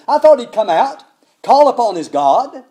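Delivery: loud, indignant grumbling; angry rant. Retold or imitated. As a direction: complain in proud outrage. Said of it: "went away in a rage".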